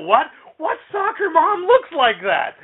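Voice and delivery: drawn out like he can't believe the question; incredulous. Retold or imitated. Panelist fires back at the soccer mom complaint, mock incredulous. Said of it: "Dan DiDio's like".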